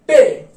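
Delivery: पे said crisp and loud; German pe. Shown as a demonstration. Teacher demonstrating German letter पे. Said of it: "German में हम इसको sound pronounce करते हैं पे".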